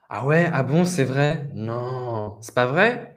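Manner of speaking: rising, surprised-sounding reactions with a drawn-out "Non"; interested listener. Shown as a demonstration. Teacher showing reactions to someone's story, interested. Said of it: "pour montrer que vous êtes intéressé".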